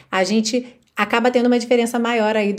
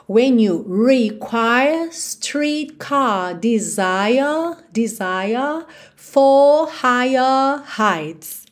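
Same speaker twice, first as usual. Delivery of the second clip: British accent; much slower than usual. Shown as a demonstration. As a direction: stretch the vowels where the R would be. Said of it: "Já com a [name] cantando". (sotaque britânico, vogais alongadas sem R)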